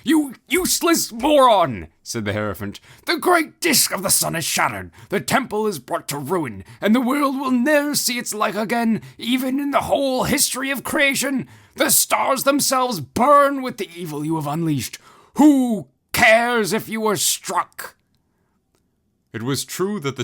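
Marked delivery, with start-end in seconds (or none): 0.0-1.9 high, loud contemptuous shriek; furious insult. Acted. The Hierophant insults Ozma. 3.0-18.0 high, loud ranting tirade, slowing at the end; the Hierophant raging over a ruined world; raging priest. Acted. The Hierophant rants.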